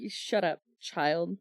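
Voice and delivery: low, flat put-down; dismissive. Retold or imitated. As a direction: brush the kid off. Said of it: "And I'm like".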